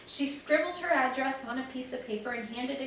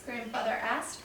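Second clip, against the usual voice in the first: plain reading voice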